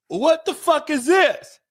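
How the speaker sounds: high-pitched, stretched-out disbelief; incredulous outburst. Acted. Defendant meets his public defender, incredulous outburst. Acting shock at meeting defender.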